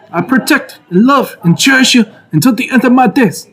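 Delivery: put-on Italian American mobster accent, laughing; mobster wedding vows. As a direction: mob boss vows. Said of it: "That's how you should say your vows".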